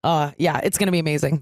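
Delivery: ordinary talk, nothing marked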